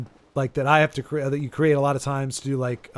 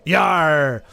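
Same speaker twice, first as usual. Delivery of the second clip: pirate Yar, a touch louder; half-hearted pirate grunt; mock pirate; 4 dB louder than usual. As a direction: growl Yar like a pirate, playfully.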